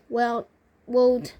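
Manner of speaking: ordinary delivery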